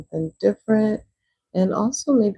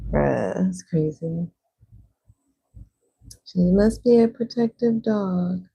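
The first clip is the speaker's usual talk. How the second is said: ordinary talk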